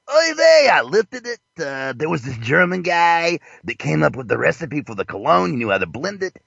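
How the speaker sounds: hammed-up character voice with sing-song line endings; Ralph Lifshitz. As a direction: put on a caricature of a boastful designer. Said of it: "My name is Ralph Lifshitz".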